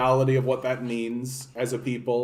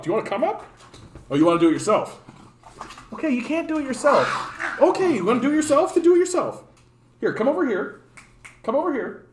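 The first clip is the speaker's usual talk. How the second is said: much higher coaxing pet voice; talking to the dog; 8 semitones higher than usual. Real, not acted. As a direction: coax the pug up in a high, sing-song voice. Coaxing the pug to jump up.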